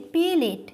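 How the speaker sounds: "প্লেট" drawn out as "pe-let"; two-syllable "pe-let" for "প্লেট", shown slowly; colloquial pronunciation. Shown as a demonstration. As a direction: slowly say "প্লেট" with a vowel between p and l. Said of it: "প্লেট থেকে কি আসছে?".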